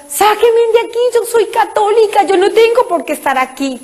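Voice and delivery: high, pleading cry to be let out; desperate cry. Retold or imitated. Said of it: "yo clamo".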